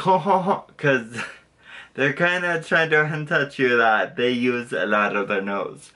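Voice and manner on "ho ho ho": high nasal "ho, ho, ho" of a cartoon Frenchman; cartoon French guy. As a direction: do a jokey French cartoon laugh through the nose. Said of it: "French guys in, like, any cartoon".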